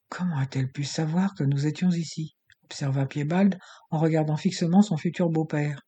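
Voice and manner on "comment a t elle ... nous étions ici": plain reading voice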